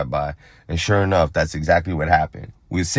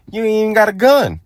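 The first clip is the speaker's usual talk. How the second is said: much higher, louder scoffing cousin voice; mocking cousin; 6 dB louder than usual. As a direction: squeak it out like the disbelieving cousin. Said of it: "My cousin's like".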